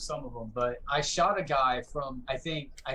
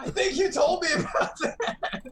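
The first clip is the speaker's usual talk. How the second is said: much higher, laughing through it; 5 dB louder than usual. Real, not acted. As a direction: crack up while talking. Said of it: "laughing, cracked up".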